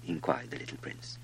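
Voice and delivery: ordinary delivery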